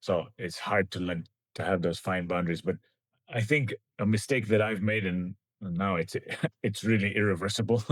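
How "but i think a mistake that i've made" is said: usual speaking voice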